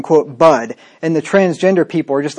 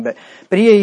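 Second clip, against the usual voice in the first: ordinary talk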